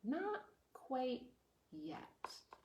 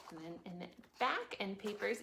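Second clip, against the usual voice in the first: plain reading voice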